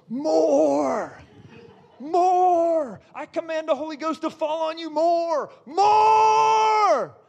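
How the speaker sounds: high, drawn-out preacher's cry; shouted command. Retold or imitated. Preacher re-enacts commanding the Spirit.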